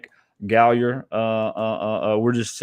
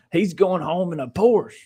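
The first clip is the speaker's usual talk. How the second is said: put-on higher, lightly held Southern country accent; 6 semitones higher than usual. Mock fan griping about the rich player, in a country accent. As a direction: finish the mock fan's gripe in a country twang. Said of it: "I always go to a country accent".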